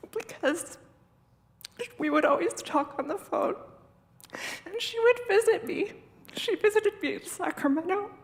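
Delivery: real tearful voice, pitch rising and breaking; emotional. Real, not acted. Mourner recalling a friend who visited, choked with emotion. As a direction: push through tears, halting between phrases. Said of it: "I'm really emotional".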